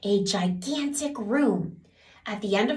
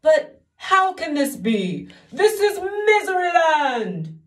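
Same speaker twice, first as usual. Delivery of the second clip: wailing king; 9 semitones higher, 8 dB louder, slower than usual. Acted. Despairing king wails.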